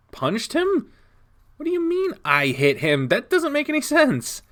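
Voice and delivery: can't believe what he just heard; high-pitched stunned questioning; incredulous shock. Acted. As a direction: voice jumps high in disbelief. Stunned at hearing he punched someone.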